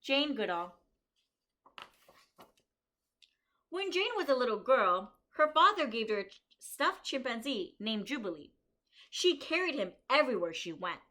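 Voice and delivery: bright, lively storybook reading for a class; reading to children. Acted. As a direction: read it out to the kids like a story. Reads picture book aloud.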